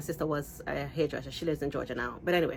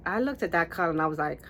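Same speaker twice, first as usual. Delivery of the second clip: usual speaking voice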